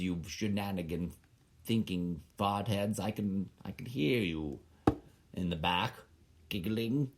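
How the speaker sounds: usual speaking voice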